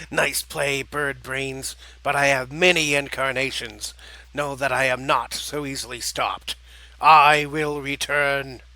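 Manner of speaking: menacing villain, emphatic bursts of volume; villain's vow, slowing to a loud drawn-out finish; taunting villain. Acted. Defeated villain vowing his return, taunting.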